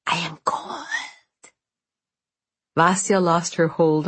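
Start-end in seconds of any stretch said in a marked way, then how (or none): 0.1-1.5 eerie ghost whisper. Acted. The ghost at the window.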